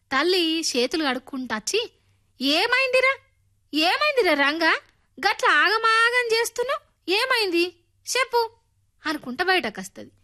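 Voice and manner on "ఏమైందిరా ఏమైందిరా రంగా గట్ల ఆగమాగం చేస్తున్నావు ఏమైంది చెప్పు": high, anxious motherly voice; a mother fretting over her flustered son; worried mother. A narrator's reading. (కంగారుపడుతున్న తల్లి గొంతు, ఎత్తైన స్వరం)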